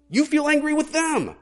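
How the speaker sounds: sudden high, loud burst of felt anger; provoked anger. Acted. Preacher to congregation, voicing provoked anger in a loud high burst. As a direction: let the anger flare up on the word angry.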